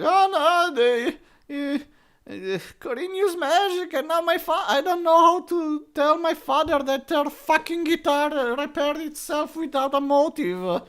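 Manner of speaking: put-on high, fretful girl voice; mock panicked girl. Girl fretting over her father's guitar, high mock voice. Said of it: "it's like".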